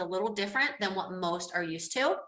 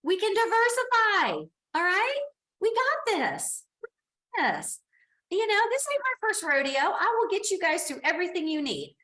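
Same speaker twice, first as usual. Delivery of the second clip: much higher and louder, cheering them on; excited rally; 10 semitones higher, 4 dB louder than usual. Real, not acted. Teacher reassuring worried students, high excited rally. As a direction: pump the class up.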